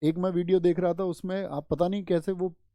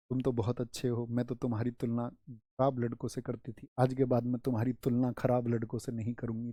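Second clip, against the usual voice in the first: put-on soft girlish voice; 6 semitones lower, 6 dB quieter than usual. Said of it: "girl speaks to boy".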